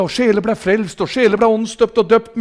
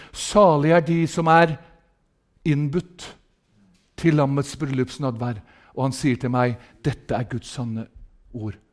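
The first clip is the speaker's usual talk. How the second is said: low, soft, slow solemn reading; softly weighted holy words; hushed scripture reading; 7 semitones lower, 7 dB quieter than usual. Real, not acted. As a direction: read the blessing quietly, with reverent pauses.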